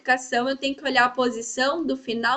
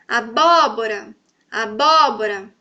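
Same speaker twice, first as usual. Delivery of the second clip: abóbora, slow and low, with a long BÓ; slow, low demo of aBÓbora, second syllable stretched; stress on BÓ; 3 semitones lower, 4 dB louder than usual. Shown as a demonstration. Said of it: "se eu falar, também consigo identificar".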